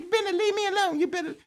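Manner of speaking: very high, lisping squeak; squeaky mock Mike Tyson threat; Mike Tyson's squeaky voice. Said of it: "he said".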